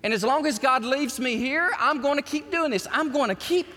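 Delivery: high, loud preacher's cry; fiery pulpit preaching, loud and high; preaching at full force. Acted. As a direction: preach it out and rally the room. Pastor preaching to congregation.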